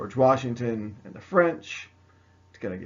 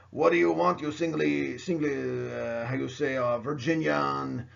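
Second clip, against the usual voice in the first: mock French accent; 3 semitones higher than usual. French soldiers answer the intruding Virginian, mock French accent. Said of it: "And the French are like".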